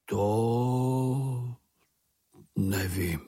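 long drawn-out, slow answer; slow, unbothered reply; ponderous Bambul. A narrator's reading. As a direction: drag out the first word, then drop.

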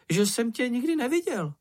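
high, squeaky, sceptical little creature; doubting Sniff. A narrator's reading. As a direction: say it high and disbelieving. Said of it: "ozval se čenich". (vysoký pochybovačný hlásek)

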